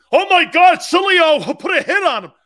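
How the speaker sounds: loud, high-pitched hysterical outcry; hammed-up shocked yelling; mock alarm. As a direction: cry it out like a panicked alarmist. Mocking others' alarmed reaction.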